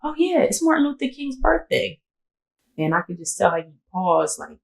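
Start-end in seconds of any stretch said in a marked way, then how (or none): 0.0-2.0 testing casual remark. Retold or imitated. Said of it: "And I was just like".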